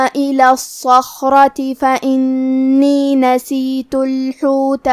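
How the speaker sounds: slow, measured tajweed chant; Quran recitation. Acted. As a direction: recite the verses slowly with full tajweed.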